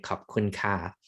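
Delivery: ordinary talk, nothing marked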